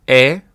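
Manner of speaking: "Ä" as an open eh sound; German Ä. Shown as a demonstration. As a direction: say "Ä" like the e in bed, held. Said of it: "If you have to say it".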